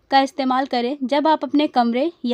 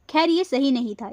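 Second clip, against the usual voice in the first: ordinary delivery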